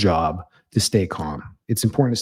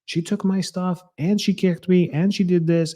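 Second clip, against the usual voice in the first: put-on much higher, whiny kid listing grievances; tattling child; 9 semitones higher than usual. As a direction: rattle off complaints like an upset little sister. Daughter voiced listing complaints.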